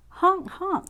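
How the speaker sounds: high honk like a goose; geese honking. Acted. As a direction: call out like passing geese. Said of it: "they cry".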